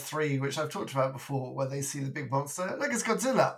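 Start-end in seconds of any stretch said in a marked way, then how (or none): 2.8-3.6 excited shout. Retold or imitated. Quoting Austin Powers movie scene.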